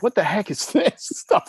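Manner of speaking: high, laughing mock-baffled friend; laughing disbelief. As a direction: act out the friends' confused laughter.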